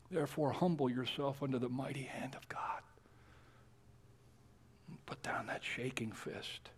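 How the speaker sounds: intimate, urgent whisper; whispered plea. Acted. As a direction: hush the room with it. Solemn appeal to the listeners.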